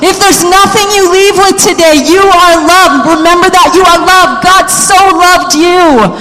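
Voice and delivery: rally the room like a revival preacher; preaching at full force. Acted. Pastor stirring the congregation, preaching at full force.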